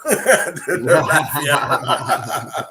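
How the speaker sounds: laughing mock-sinister the mafia; mock ominous. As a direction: drag it out with a laugh like a joke threat. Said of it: "repeats it stretched".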